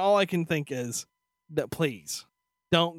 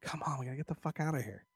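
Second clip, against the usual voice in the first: hurried mutter; 4 semitones lower, 12 dB quieter, faster than usual. Retold or imitated. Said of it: "I'm like".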